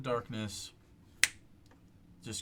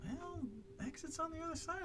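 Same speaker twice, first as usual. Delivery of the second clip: much higher put-on character voice; NPC guide voice; 8 dB quieter than usual. NPC guide warning the party, high character voice. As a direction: explain the danger in a high character voice.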